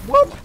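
very high, loud whooping; celebratory whoops. Real, not acted. Gamer cheering his kill, high celebratory whoops. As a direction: whoop sharply upward in triumph. Got an enemy.

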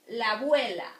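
la abuela run together as labuela; linked vowels. Shown as a demonstration. Teacher to students, linking the two A sounds. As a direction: link la and abuela into one. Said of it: "You just link those two".

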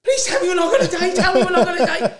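high, loud, laughing imitation of a terrified passenger; mock panicked plea. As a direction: beg like you're sure the plane will crash. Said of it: "She goes".

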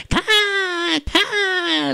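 high cawing; crow caws. Acted. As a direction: caw like a crow. Crows in the sky.

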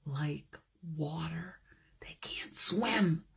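soft unvoiced whisper. A narrator's reading. Telling children about bees, whispered. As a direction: hush it like a secret. Hushed storytelling.

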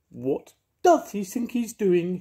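a furious diva cat shrieking. Acted. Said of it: "she shrieked".

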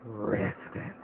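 whispered start, then a loud punch on Evil; spooky title voice. Acted. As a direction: breathe Resident, then slam Evil. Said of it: "saying the title on a spooky voice".

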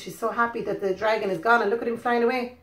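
ordinary delivery

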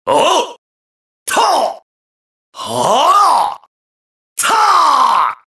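short high combat yells; sharp high-pitched striking shouts; attack cries. Acted. Game fighter landing blows, high effort cries.

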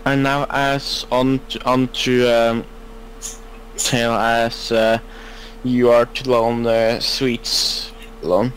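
drowsy mumbling half asleep; sleep talking. Real, not acted. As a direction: mumble nonsense in your sleep. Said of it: "Are you sleep talking there".

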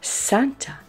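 usual speaking voice

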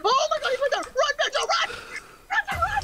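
frantic high yelling to run; screaming at a teammate to flee; panicking gamer. Real, not acted. Teammate chased by killer, screaming.

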